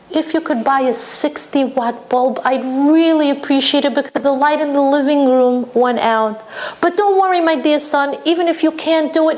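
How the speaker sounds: mother slipping in the light bulb request; sweet guilt-tripping mother. Retold or imitated. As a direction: guilt him gently, no pressure. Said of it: "that's when she says".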